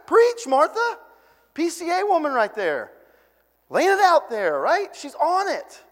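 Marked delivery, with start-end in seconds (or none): 0.1-1.0 high, bright call of approval; cheering preach. Retold or imitated. Preacher urging Martha on, high cheering call. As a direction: egg her on from the pews.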